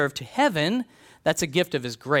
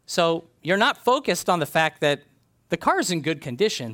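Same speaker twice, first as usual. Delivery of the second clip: normal talking voice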